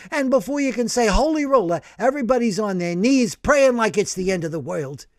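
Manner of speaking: gruff, rambling storyteller voice; a rough guy spilling his wild story; brash old working man. Acted. Stanley rambling to the priest, brash and worked up.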